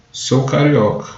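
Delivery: ordinary talk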